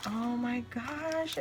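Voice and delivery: normal talking voice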